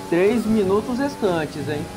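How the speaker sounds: live race play-by-play call; motorsport commentator. Acted. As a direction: announce it like a race broadcaster. Announcing time left in qualifying.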